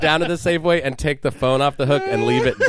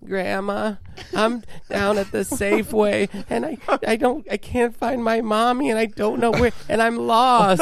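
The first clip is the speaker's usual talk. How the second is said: put-on high whiny little-boy voice, pitched way up; lost little kid; 6 semitones higher than usual. Prank-calling a stranger's grandma as a lost child, high kid voice. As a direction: play a small boy who can't find his mommy.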